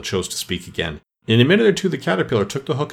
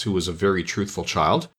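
usual speaking voice

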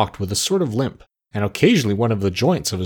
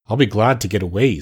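ordinary delivery

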